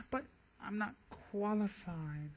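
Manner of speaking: slowed, soft, fake-modest protest; fake pretentious humility. Member protesting with fake pretentious humility. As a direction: fish for a compliment. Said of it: "fake pretentious humility".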